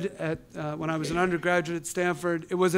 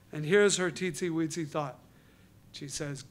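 normal talking voice

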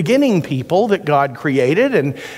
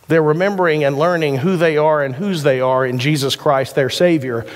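ordinary delivery

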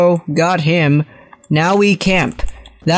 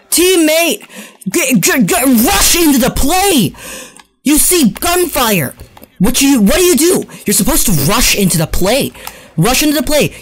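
scolding yell; 5 semitones higher than usual. Real, not acted. Gamer scolds his teammate, high exasperated yell. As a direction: chew out the teammate.

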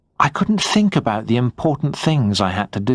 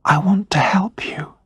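soft unvoiced whisper of comfort; quiet whisper; 9 semitones higher than usual. A narrator's reading. Wife holding his face, whispering comfort. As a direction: whisper it close, to soothe. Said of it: "she said quietly".

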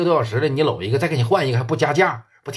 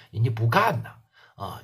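ordinary delivery

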